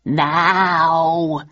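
drawn-out villainous stage delivery; Richard's cruel reply. A narrator's reading. Richard answering the audience, cruelly theatrical. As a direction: savour it with a cruel smile. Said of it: "replied Richard with a cruel smile".

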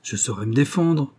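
plain reading voice

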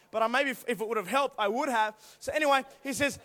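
ordinary talk

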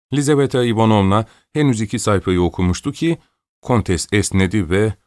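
plain reading voice

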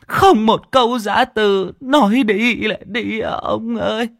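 high-pitched keening widow's lament; tearful wailing. Acted. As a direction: wail it out on a high, held note. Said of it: "nước mắt ngưng tròng".

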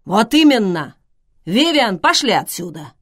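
loud, emphatic agreement and urging; exclaiming. A narrator's reading. Schweiger urges Vivian to leave, exclaiming. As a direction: exclaim it, then urge firmly. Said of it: "Швайгер воскликнул".

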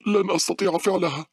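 very high, loud despairing cry; desperate scream. A narrator's reading. Girl running for closing doors, desperate high scream. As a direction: cry out that hope is gone. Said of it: "صرخت باولا بيأس".

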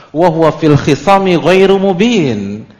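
loud, high chanted Arabic verse; Quranic recitation. Acted. Preacher to congregation, chanting the verse loudly. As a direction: intone the verse to the crowd.

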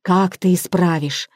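usual speaking voice

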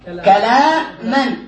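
very slow, stretched كلاما, each syllable held; drawn out for the class. Shown as a demonstration. Teacher stretching the word for the class, very slow. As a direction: spell out كلاما slowly so students hear every sound. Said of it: "هذا الفعل كلم".

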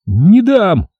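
high yelp of defiance; young soldier's high-pitched squeal; squealed refusal. A narrator's reading. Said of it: "взвизгивает солдатик".

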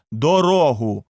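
loud demand for the road; crowd shout. A narrator's reading. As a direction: call out to clear the way.

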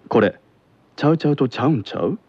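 Kansai dialect. Shown as a demonstration. Teacher demonstrating advanced syntax, quick Kansai dialect. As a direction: rattle it off with a Kansai lilt. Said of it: "では続いて".

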